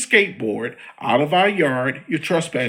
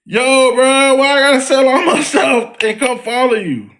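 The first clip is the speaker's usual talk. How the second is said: loud, fast, laughing plea to a buddy; hammed-up street plea, much higher and louder; excited mock plea; 7 semitones higher, 6 dB louder than usual. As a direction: holler at Jesus between laughs. Pleading loud to Jesus, laughing.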